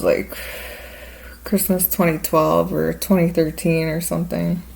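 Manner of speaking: normal talking voice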